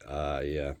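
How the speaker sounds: ordinary talk, nothing marked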